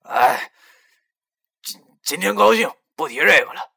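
loud, high, stammering cut-in; loud interruption. A narrator's reading. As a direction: jump in loudly to change the subject. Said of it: "林叔忽然打断他的话". (大声抢话打断)

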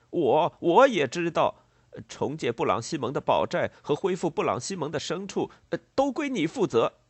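loud, high-pitched stammering accusation; angry. A narrator's reading. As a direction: lay the blame furiously, stumbling over words. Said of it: "带着一种愤怒的神气接着说". (愤怒而结巴地指责)

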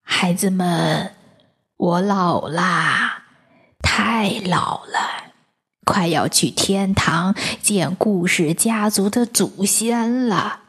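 put-on slow, drawn-out old man's voice; sorrowful aged storyteller; weary old grandpa. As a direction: confess slowly, worn out with age. Weary grandpa confesses old age.

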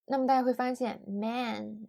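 ordinary talk, nothing marked

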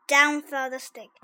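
ordinary delivery, nothing marked

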